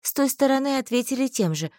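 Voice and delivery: ordinary delivery, nothing marked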